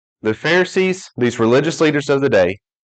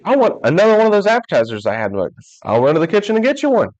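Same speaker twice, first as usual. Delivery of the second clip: excited preaching; 8 semitones higher than usual. Acted. Preaching at full force about the buffet.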